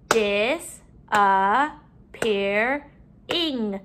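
"Disappearing" very slowly, in four beats; syllable by syllable. Shown as a demonstration. Teacher counting syllables in the word, broken up. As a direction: stretch and separate each syllable of "Disappearing". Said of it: "break up our syllables".

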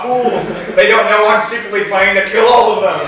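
loud, high evil gloating; gloating villain. Retold or imitated. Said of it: "his inner monologue".

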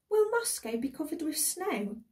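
put-on high-pitched little girl's eager question. A narrator's reading. Daughter asks her departing father about Moscow, little girl voice. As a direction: ask it high, like a small child. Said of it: "said the little girl Janet".

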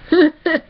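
small laugh of relief; short laugh. Real, not acted. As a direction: let out a quick relieved laugh. Relieved laugh after saying it's over.

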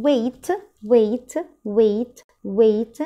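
ويت said slowly and clearly for repetition; slow model word. Shown as a demonstration. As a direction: draw out ويت so the class can copy it. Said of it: "repeat after me". (نطق ويت ببطء للتكرار)

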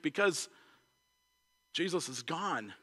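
hushed, low, halting reply; retold quiet sorrow; subdued grief. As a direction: voice a grieving woman very softly, with a pause. Voicing grieving Mary at the tomb.

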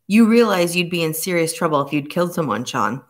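ordinary delivery, nothing marked

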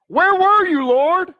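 high, loud cry of reproach to God; accusing cry. Acted. Voices human blaming of God.

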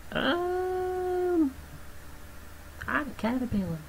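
high, soft little critter voice; silly creature voice. Pretend. Gamer voicing a small creature, high and soft. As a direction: speak as the tiny creature. That's not a caterpillar, [name].

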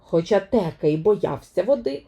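plain reading voice

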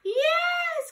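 high eager yes; cried yes. Acted. Witch welcoming the frog, high eager cry. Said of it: "cried the witch".

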